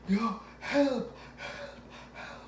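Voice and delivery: high, thin mock call for help; imitating his panicked sleep cry; retold cry for help. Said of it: "That's how I sounded".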